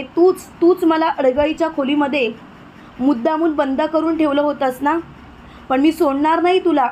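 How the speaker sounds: plain reading voice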